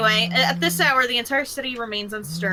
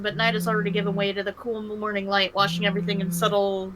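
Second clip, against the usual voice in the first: ordinary delivery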